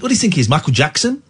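usual speaking voice

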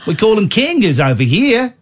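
hammed-up Aussie host with an invitation; Australian accent. Shown as a demonstration.

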